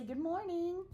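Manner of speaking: high, chirpy nurse greeting; happy and cheerful. Retold or imitated. Nurse greeting a patient in the morning, happy and cheerful. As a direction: greet brightly, pitched well up. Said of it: "You come in so happy".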